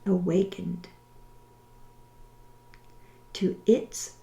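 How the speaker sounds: slow, deliberate, over-enunciated prayer. Shown as a demonstration. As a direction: pray each line slowly, with long pauses. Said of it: "I will say it slowly".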